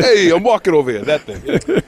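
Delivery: laughing New York wiseguy voice; playful Goomba accent, laughing through it; New York Italian accent. Acted. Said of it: "He's a real Goomba".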